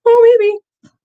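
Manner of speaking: high, loud chirpy nurse imitation; mock nurse voice. Nurse voiced in retold exchange.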